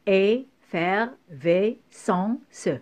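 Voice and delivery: "effervescence" very slowly, each syllable set apart by a pause; teacher's slow syllable-splitting of "effervescence". Shown as a demonstration. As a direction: cut EFFERVESCENCE into separate syllables. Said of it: "couper le mot par syllabe".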